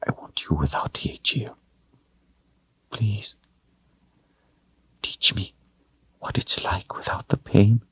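soft begging whisper; pleading whisper. A narrator's reading. Denna pleading intimately.

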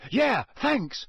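high call over the shoulder; shouting back. A narrator's reading. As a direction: shout it back as you leave. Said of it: "Ron shouted over his shoulder".

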